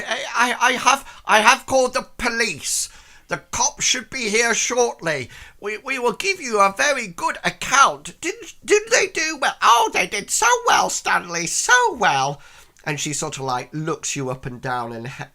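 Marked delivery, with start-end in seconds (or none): 0.1-9.5 put-on high, loud old man voice; old man Stanley. Old man Stanley telling the heroes police are coming. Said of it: "he sort of like says". 9.6-12.4 put-on very high, loud gushing old lady; old woman. Old woman agreeing with her husband Stanley. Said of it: "old woman answers husband".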